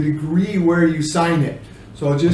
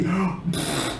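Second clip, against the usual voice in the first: gasp; 4 semitones lower, 10 dB quieter than usual. Shown as a demonstration. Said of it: "they were shocked".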